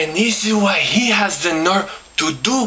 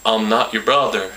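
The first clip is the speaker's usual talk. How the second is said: much lower put-on voice, dead serious; much lower voice of a stranger refusing the greeting; cold stranger; 9 semitones lower than usual. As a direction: shut him down, deep and blunt. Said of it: "he looks me in the eye and he says".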